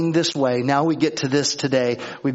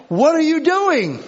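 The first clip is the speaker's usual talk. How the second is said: high, loud, incredulous yell, repeated for the congregation; exasperated yell; 13 semitones higher, 5 dB louder than usual. Retold or imitated. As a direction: replay the yell at the honking car. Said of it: "understand what I'm talking about".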